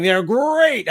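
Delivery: high, rolling "great"; playful commercial-mascot swoop up on "great"; Frosted Flakes slogan. Acted. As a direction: toss out the cereal catchphrase with gusto. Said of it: "did you have the Frosted Flakes commercial".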